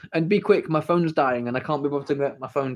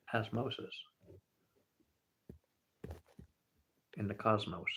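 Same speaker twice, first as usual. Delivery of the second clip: Matthew McConaughey voice; 4 semitones lower, 14 dB quieter than usual. Retold or imitated. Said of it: "That's how Matthew McConaughey talks".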